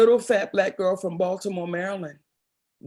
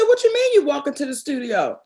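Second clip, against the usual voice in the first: disbelieving question; 7 semitones higher, 4 dB louder than usual. Retold or imitated. Retelling James's disbelief.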